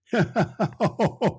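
a high chuckle of delight; light, merry giggle; jolly high laugh. Acted. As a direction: laugh along with the flowers. Narrator laughs with Claus.

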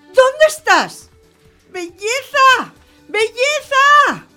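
high, drawn-out calls from a balcony; calling out, clamoring. Acted. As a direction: owner calling her runaway pet home. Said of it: "que clama desde el balcón". (llamada aguda y a voces desde el balcón)

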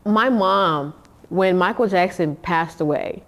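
ordinary talk, nothing marked

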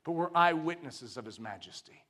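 ordinary delivery

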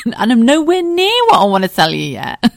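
high, loud, laughing through the words; real laughter breaking into a squeal; laughing exclamation. Real, not acted. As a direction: burst out laughing at your own rambling. Laughing at how long she's talked.

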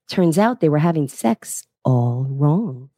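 usual speaking voice

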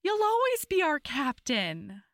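high, indignant crew voices, then softening; crew's loyal protest. Retold or imitated. Crew protests to their dejected captain, high and indignant. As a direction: rally round the sulking captain.

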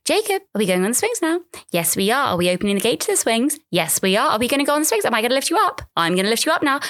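high, sing-song parent narrating every step; mock sugary parent voice at the swings; mocking running commentary. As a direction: ham up the gentle parent's commentary. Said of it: "everybody got a commentary on it".

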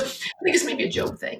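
very high, thin tearful squeak; choked-up retelling. Retold or imitated. Mother quoting her young son on Job, choked with tears. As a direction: squeeze it out softly through tears. Son's words retold while choked up.

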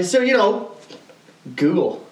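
ordinary talk, nothing marked

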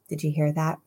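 ordinary talk, nothing marked

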